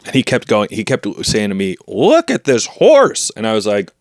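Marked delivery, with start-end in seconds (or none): 1.9-3.3 high, drawn-out proud exclamation; excited boasting. Acted. Tiny landlord showing off the rat he rides, excited. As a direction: show it off, stretched and pitched up.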